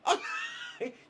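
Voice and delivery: shrieking high laugh; high laughter burst. Real, not acted.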